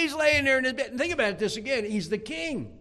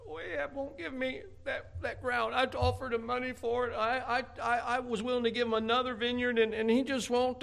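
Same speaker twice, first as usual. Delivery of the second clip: high, whimpering spoiled king; whiny Ahab; 10 semitones higher than usual. Acted. Preacher playing Ahab complaining to his wife, high whimpering whine. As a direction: whine like a spoiled little boy. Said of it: "He says".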